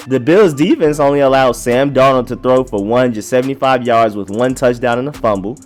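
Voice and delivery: ordinary delivery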